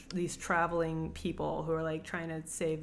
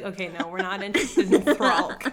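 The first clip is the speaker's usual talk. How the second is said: laughing dismissal; 8 dB louder than usual. Retold or imitated.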